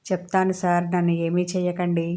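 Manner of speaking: ordinary delivery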